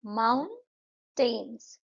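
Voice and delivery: माउंटेन्स said very slowly in two beats, माउन-टेन्स; syllable beats. Shown as a demonstration. Teacher counts the beats in माउंटेन्स for pupils. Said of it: "दो beats".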